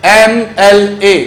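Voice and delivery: "एमएलए" slowly split into em, el, ay; pronunciation demo. Shown as a demonstration. Teacher showing the opening vowel sound, "एमएलए" slow, letter by letter. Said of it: "इसका pronunciation क्या".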